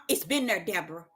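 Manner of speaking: God answering Deborah, low and calm. Pretend. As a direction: speak as a patient God. God speaking to her.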